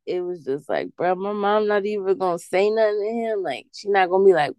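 ordinary delivery

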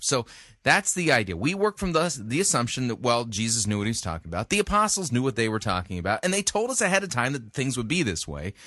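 normal talking voice

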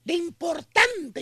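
normal talking voice

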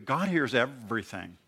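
usual speaking voice

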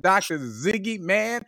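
normal talking voice

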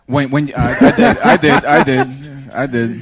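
laughing while talking; chuckling through the words. Real, not acted.

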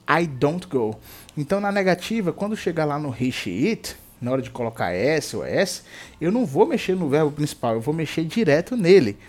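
ordinary delivery

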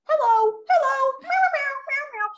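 very high meowed hellos, like a parrot; squeaky-high cat calls sliding into meows; talking cat. Retold or imitated. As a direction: imitate a chatty cat squawking human words. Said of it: "saying hello like a parrot".

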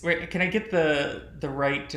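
normal talking voice

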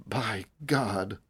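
low, hushed exclamation; soft, low and hushed; whispered awe. A narrator's reading. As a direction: drop to a near whisper. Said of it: "Dirk whispered".

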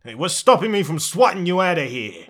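loud, bristling dragon challenge; threatening dragon. Acted. Dragon threatens the intruders, annoyed and loud.

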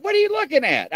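high-pitched imitation of a puzzled companion; mock curious question. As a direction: ask it high, as if retelling someone's question.

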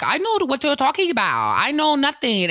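high-pitched protest of innocence; lightly acted, high insistent denial; mock denial. Retold man denying it to the officer, high mock protest.

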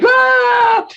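high scared shriek; children screaming. Acted. Children fleeing the monster, a high panicked shriek.

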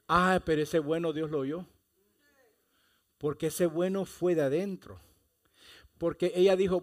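ordinary talk

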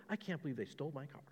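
ordinary talk

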